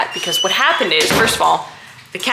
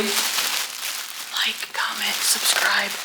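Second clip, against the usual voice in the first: slow whispered prompt; whispering; 2 semitones higher, 8 dB quieter, much slower than usual. Acted. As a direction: whisper the line to a partner. Prompts partner to say outro.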